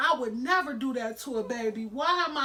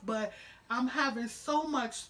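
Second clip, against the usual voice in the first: usual speaking voice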